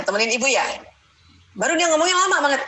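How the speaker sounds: ordinary talk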